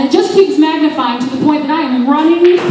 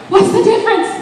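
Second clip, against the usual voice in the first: high, laughing, clueless question; 10 semitones higher, 3 dB louder than usual. Retold or imitated. Asking the friend about cleaners, clueless. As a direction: ask it totally baffled. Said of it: "quarrel with friend".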